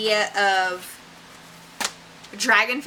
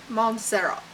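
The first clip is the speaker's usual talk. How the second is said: put-on softer Spanish accent, settling on the name with a falling close; 4 dB quieter than usual. Reader settling on a hero's name, in a light Spanish accent. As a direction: commit to the Spanish accent, quietly. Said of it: "trying to say it with an accent".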